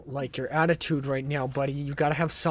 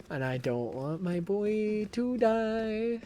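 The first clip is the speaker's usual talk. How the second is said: mock mourning; 5 semitones higher, much slower than usual. Mock grief over dying Leon.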